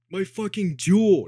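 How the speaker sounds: loud, high, drawn-out mock cry of discovery; hammed-up shout on finding lost treasure; imitated joyful outburst. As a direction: holler it slowly, imitating an overjoyed friend.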